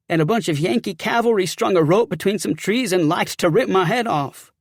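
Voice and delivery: worked-up soldier spilling his story; rattled sergeant. Acted.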